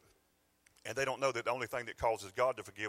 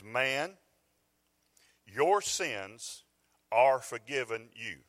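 proclaiming Jesus' words; 7 dB louder, much slower than usual. Acted. Preacher voicing Jesus' words.

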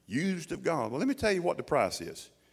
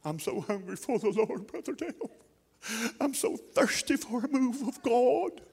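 a hint of a whiny churchgoer, higher; mock pleading believer; 9 semitones higher than usual. Mocking a believer begging the preacher, mildly whiny. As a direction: lightly mimic a pleading congregant. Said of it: "what about the Bible".

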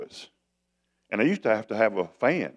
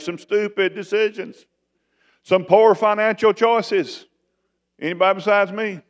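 higher and louder, calling out to the congregation; fervent preaching; 8 semitones higher, 5 dB louder than usual. Real, not acted. Preacher calling out to his church, higher and louder. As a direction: raise it up and press the crowd for an answer.

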